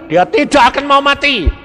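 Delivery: ordinary talk, nothing marked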